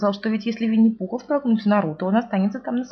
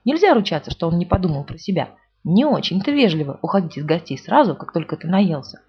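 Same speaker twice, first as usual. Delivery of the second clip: usual speaking voice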